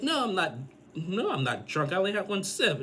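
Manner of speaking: high-pitched mock protest; indignant denial. Girlfriend denying to boyfriend that she's drunk, retold indignantly. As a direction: imitate her protesting, voice jumping way up.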